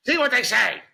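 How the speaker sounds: high, loud mock wife; high mocking witch voice; wife's witch voice. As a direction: sing it out like a nagging witch. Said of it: "the cadence that you have and the voice is hysterical".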